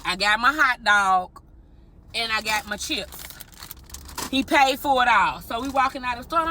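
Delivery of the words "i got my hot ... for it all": normal talking voice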